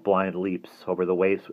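ordinary delivery, nothing marked

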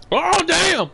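loud, high amazed yell; excited shout. Real, not acted. Gamer yelling at something wild on screen. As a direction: blurt out a big wow. Amazed reaction mid-game.

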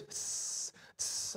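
short, sharp "tss" noises; repeated sibilant hiss; imitated cicada hiss. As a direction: mimic a hissing sound from the kitchen. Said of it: "I hear this sound in the kitchen".

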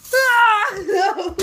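high, loud cry of no; alarmed yell. Real, not acted. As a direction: yelp it in real fright. Recoiling in alarm.

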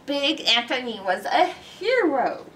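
ordinary delivery, nothing marked

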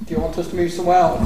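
ordinary talk

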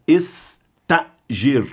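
estágir said very slowly with a glottal stop; slow drilled Arabic. Shown as a demonstration. Teacher drilling estágir slowly for students. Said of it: "Isso é uma parada vocálica".

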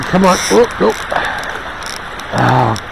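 ordinary talk

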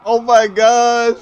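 shocked yell. Real, not acted. Wild crash during chase.

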